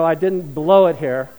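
usual speaking voice

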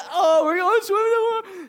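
high, bright-up squeal of joy; delighted little girl. Retold or imitated. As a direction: sing it out high like a happy toddler. Daughter voiced, delighted.

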